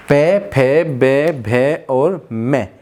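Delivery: "पे, फे, बे, भे और मैं" stretched, lips meeting on each; slow lip sounds. Shown as a demonstration. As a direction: drill "पे फे बे भे मैं" slowly. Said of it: "बोलते रहिए साथ साथ".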